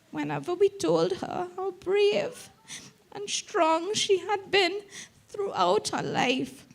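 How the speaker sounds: fighting back real tears mid-sentence; tearful eulogy for her mother; crying. Real, not acted. Eulogy, speaker breaks down.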